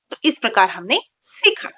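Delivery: usual speaking voice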